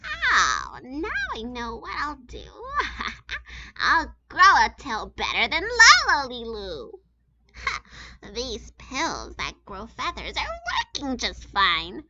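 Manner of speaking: high, gleeful plotting with giggles; scheming girl bird. A narrator's reading. Gertrude plotting to beat Lola, high gleeful giggles. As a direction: cackle over your clever plan.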